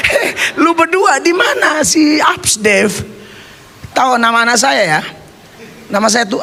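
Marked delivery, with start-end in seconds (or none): 0.1-2.9 slow, higher mock call of alarm. Father shouting at the cousins for his lost son, mock alarm. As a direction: call out for the missing boy.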